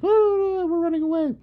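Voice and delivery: high-pitched fake panic squeal; mock fleeing beasts. Storyteller voicing beasts fleeing Enkidu, mock high-pitched panic. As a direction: voice scared animals in a comic high pitch. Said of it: "they all shy away from him and run away".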